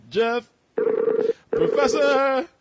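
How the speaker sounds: high, drawn-out call for Jeff. Real, not acted. Host calls for Professor Jeff on the line, high drawn-out call. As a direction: sing out his name slowly.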